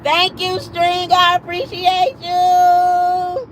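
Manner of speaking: high, slow, hammed-up teary voice; mock tearful thanks. She thanks her coworkers in mock emotion, hammed-up teary. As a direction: pretend to choke up with gratitude.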